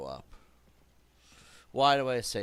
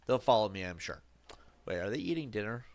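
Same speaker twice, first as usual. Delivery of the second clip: ordinary talk, nothing marked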